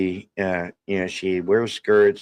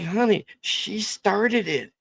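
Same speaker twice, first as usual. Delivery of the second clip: defensive; 12 semitones higher, slower than usual. Retold or imitated. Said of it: "I'm like".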